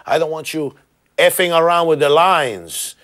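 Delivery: put-on angry Cuban accent; thick Cuban accent, scolding. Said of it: "with a very thick Cuban accent".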